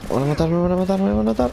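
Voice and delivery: high panicked pleading. Real, not acted. About to die in game.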